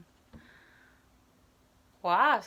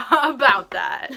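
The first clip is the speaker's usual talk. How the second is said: laughing; 7 dB louder, much slower than usual. Real, not acted. Echo while laughing.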